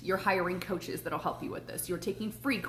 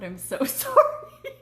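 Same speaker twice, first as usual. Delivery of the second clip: laughing apology; 11 semitones higher, 7 dB louder, slower than usual. Retold or imitated. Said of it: "And she was like".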